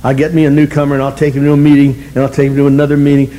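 ordinary delivery

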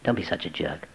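a small voice inside urging quietly; little inner whisper. Retold or imitated. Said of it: "went off like a little whisper".

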